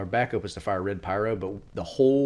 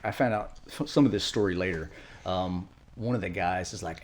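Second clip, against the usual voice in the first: ordinary delivery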